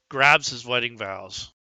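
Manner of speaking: ordinary talk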